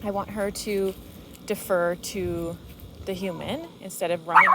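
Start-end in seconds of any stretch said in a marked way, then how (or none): none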